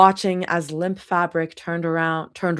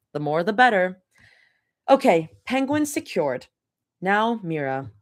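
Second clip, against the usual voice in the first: usual speaking voice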